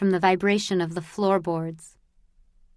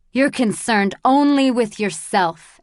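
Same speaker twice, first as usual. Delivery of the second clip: louder, accusing; angry rebuke; 3 semitones higher, 5 dB louder than usual. Acted. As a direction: accuse him with contempt. Andrus berates bald man.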